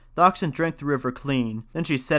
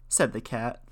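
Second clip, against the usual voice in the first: ordinary delivery, nothing marked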